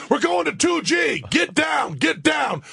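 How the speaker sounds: high urgent yell, get down; shouted warning. Retold or imitated. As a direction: yell it like a panicked crew. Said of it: "all the astronauts are going".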